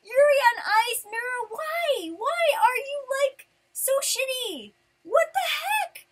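high-pitched exasperated rant; loud, indignant complaint at a shoddy prize; mad. Retold or imitated. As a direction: reenact outraged disbelief, voice shooting up. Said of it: "I was so mad".

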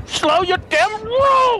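slow shout. Real, not acted. Yelling at fleeing player.

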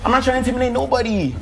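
real heated defence in a house argument; upset. Real, not acted. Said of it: "I'm upset".

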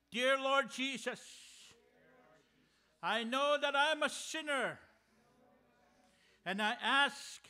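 solemn sinner's prayer led aloud; leading prayer. Acted.

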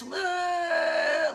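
high, loud imitated child whine; drawn-out mock wail of a whining kid; whiny wail. Mom mimics her four-year-old's all-day noise, whining. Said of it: "like, whining all day".

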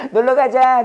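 playfully quit like a fed-up learner; giving up. Acted. Student abandoning study to go play, mock giving up. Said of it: "그리고 포기했지".

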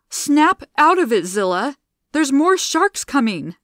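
loud, high urgent yell. A narrator's reading. Gianna yelling at grieving Zilla, urgent. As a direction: yell to snap her out. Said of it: "Gianna yelled".

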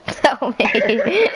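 laughing plea. Real, not acted. Begging a friend for a spelling, laughing. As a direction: beg through real laughter.

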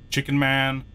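ordinary talk

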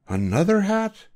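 high, incredulous question; astonished boy. Acted. Boy hears of a second hat, astonished. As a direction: let disbelief lift the voice right up.

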